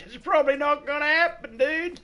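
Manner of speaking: loud, squeaky-high comic delivery; joking high-pitched rebuff. Real, not acted. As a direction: let the voice shoot up, about to laugh. Joking rebuff to a returning viewer.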